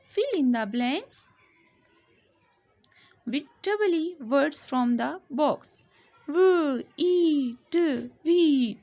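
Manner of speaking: very slow, word by word; slow classroom reading of the instruction; slow dictation reading. Shown as a demonstration. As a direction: read the instruction aloud slowly. Reading the question slowly.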